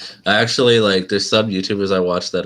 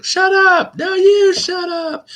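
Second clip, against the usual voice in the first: very high, loud cartoonish squabble; mock squabble; 6 dB louder, much slower than usual. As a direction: shout it back in a high put-on voice. Acting out a silly squabble.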